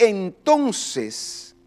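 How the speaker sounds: very slow, suspenseful scripture reading; drawn-out reading. Retold or imitated. Preacher opening the verse, drawn out. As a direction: stretch the word out for suspense.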